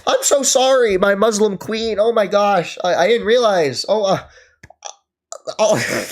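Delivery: high, loud, simpering fake apology; mock grovelling apology. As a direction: grovel in a sarcastic, mincing voice. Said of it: "you need to just be like".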